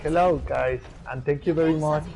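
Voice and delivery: loud, calling out to the team; shouting. Real, not acted. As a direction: call out loudly over voice chat. Player greeting team on voice chat.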